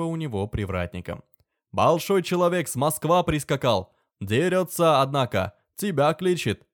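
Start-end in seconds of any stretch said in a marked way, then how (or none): 1.7-6.7 loud, high alarmed Tatar servant; Tatar accent. Acted. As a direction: shout the news in a Tatar accent. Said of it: "голос татарина Касима".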